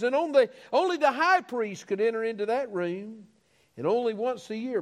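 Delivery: high, ringing sermon cadence with falling phrase ends; fervent preaching. Real, not acted. Preaching with rising fervour.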